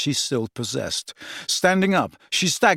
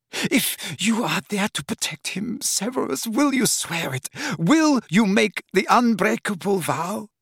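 1 high desperate plea; 7 semitones higher than usual. A narrator's reading. Narcissa begging for the vow.

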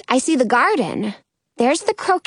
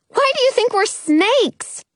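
baffled; 11 semitones higher than usual. A narrator's reading. Baffled reply to accusing pigeon.

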